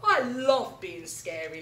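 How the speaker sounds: loud gloating laugh then slow line; cackle, then savour the words. Acted. Alan the storyteller thrills the animals, laughing. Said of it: "laughed Alan".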